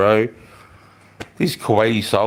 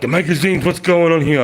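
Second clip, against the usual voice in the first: high, slightly louder mock interrogation; suspicious guards; 8 semitones higher, 5 dB louder than usual. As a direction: play the guards demanding answers, voice raised high. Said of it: "And they're like".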